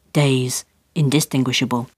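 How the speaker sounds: usual speaking voice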